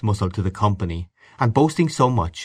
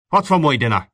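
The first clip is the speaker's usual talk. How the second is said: raised, higher-pitched demand; angry shout; 5 semitones higher, 4 dB louder than usual. A narrator's reading. Father demands dinner from his son, shouting. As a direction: shout it like a fist on the table. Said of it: "banged his fist on the table and shouted".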